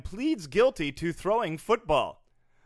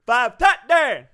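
high, slow, loud cheering call; 10 semitones higher, 14 dB louder, much slower than usual. Acted. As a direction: cheer it like a stadium crowd. Mock crowd cheer.

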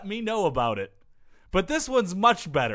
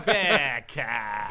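mock Australian; 6 semitones lower than usual. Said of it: "slow and deliberate with their pronunciation".

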